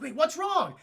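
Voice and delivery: high-pitched mock concern; alarmed question. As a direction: act suddenly thrown and worried.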